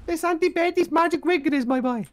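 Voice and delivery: ordinary talk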